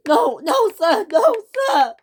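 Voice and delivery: very high, loud pleading cry; the engine pleading with the boss, high loud cry. Acted. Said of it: "Caitlin cried".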